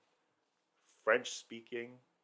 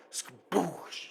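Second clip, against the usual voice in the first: mouthed "skaboosh" blast, pitched up; imitated explosion boom; 6 semitones higher than usual. Storyteller describing a backyard blast, imitated explosion. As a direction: burst it out as a cartoon explosion.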